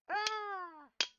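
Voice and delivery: high, drawn-out rooster crow. Acted. Knight crows to lure the monster in, high rooster crow. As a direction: crow like a cockerel, long and high. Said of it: "banging and crowing".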